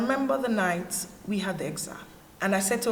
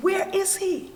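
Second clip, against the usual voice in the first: retold cry of doubt, much higher; anguished demand; 11 semitones higher than usual. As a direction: demand it of an absent God, pitch leaping up. Quotes her despairing question to God.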